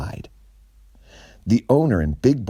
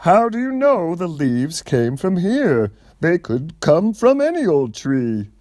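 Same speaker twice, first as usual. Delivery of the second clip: high, louder defensive owner voice; 11 semitones higher, 4 dB louder than usual. A narrator's reading. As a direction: push back, a man caught out. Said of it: "still defensive and said".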